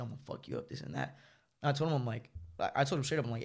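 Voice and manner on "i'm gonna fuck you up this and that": much lower mocking tough guy; deep mock threat. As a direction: drop low like a thug. Mimics angry boyfriend.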